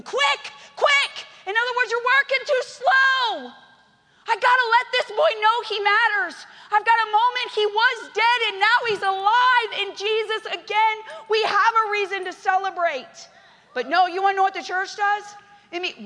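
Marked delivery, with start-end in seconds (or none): 0.0-1.3 high, loud urgent call; urgent shout. Retold or imitated. Father shouting to servants to hurry, high and loud. 1.5-15.0 loud, high preacher's cry; full-force preaching. Acted. Pastor exhorting the church to celebrate, loud and high.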